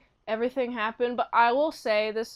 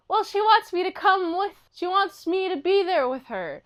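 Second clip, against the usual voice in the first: higher, lightly acted little kid; child explaining, pitched up; little boy; 7 semitones higher than usual. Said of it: "the little boy's like".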